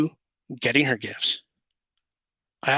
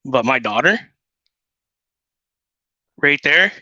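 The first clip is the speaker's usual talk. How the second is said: usual speaking voice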